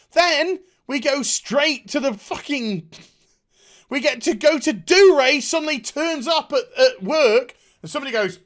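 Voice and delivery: usual speaking voice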